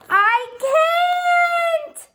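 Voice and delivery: high, loud panicked shout; panicked cry. A narrator's reading. Kevin crying out as his tree leans, high and loud.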